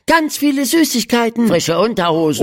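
put-on high, quick excited children's voices; eager kids calling out. A narrator's reading. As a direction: pile in like excited schoolkids. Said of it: "Alle riefen durcheinander".

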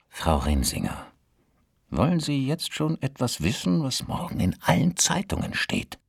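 conspiratorial. Acted. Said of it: "fragte Leo in verschwörerischem Ton".

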